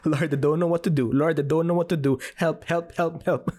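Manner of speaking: half-laughing replay of a frantic prayer; retold desperate prayer. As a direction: rush out a panicked plea to God. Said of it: "all I did was the most powerful prayer".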